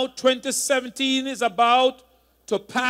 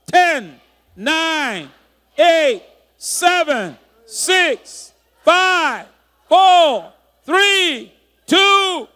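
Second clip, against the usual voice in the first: rising, louder shouted countdown; new year countdown crowd; 7 semitones higher, 8 dB louder, much slower than usual. Real, not acted. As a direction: count down to midnight, building to a shout. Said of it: "So let's count him out".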